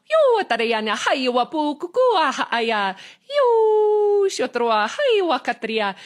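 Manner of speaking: high, singsong channelled tongue, flowing syllables; light language. Acted. Channelling light language to the live viewers in a high singsong.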